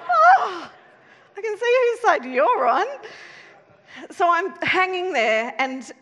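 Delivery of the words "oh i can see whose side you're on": high, loud, hammed-up indignation; mock offended. Speaker teasing the laughing audience, mock offended. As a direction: playfully scold the audience.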